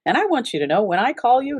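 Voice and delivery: ordinary talk, nothing marked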